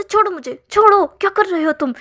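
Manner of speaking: high, loud protest to be let go; struggling yell. Acted. Wing yells at his boss to let him go, loud. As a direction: yell as you are dragged away.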